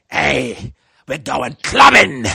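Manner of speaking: slow, loud call of hey, we're going clubbing; loud party invite. Retold or imitated. Mate drunkenly calls him to go clubbing, loud and slow. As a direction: boom it out like a drunk mate hyped for the club. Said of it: "and he says".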